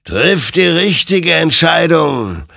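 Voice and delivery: loud, high, drawn-out exhortation; a spectre urging the right choice, slowly; admonishing. A narrator's reading. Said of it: "ermahnte ihn sein Gegenüber". (eindringlich mahnend, laut und gedehnt)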